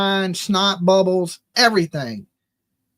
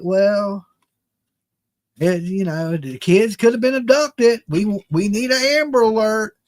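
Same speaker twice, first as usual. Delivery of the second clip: mock calm. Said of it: "so calm and just be like".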